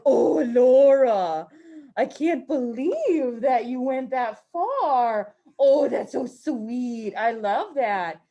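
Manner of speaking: slow, loud, sugary grandma voice; imitating sweet grandma. Teacher imitates Bo's sweet Midwestern grandma, slow and cooing. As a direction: coo it out slowly like a doting grandma. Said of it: "she always talks like this".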